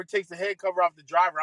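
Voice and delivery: normal talking voice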